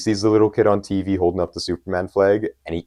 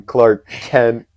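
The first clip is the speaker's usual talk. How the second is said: higher, louder name announcement; emphatic reveal; 5 semitones higher, 6 dB louder than usual. Real, not acted. Host naming their favourite character of the year, emphatic reveal. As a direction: land the name like a winner announcement. Reveal of number one pick completed.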